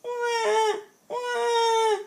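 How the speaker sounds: very high, drawn-out mock baby cry; baby wailing. A narrator's reading. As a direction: imitate a wailing newborn. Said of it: "The baby wailed".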